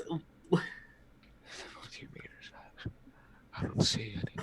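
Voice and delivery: voiceless, hushed reply; faint, withdrawn whisper; shaken whisper. Acted. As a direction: whisper it, barely audible. Shaken character argues they are safe.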